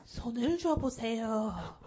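slow, solemn mock cult leader. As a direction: intone it slowly like a guru. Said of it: "교주 같아요". (교주처럼 느리게 읊조리기)

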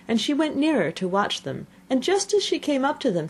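plain reading voice

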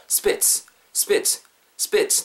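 "spits" as one short, forceful burst; short stressed "spits". Shown as a demonstration. Teacher drilling the key word for learners, "spits" short and stressed. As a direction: punch "spits" short with a sharp final ts. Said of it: "spit 자체에다 강세를 주세요".

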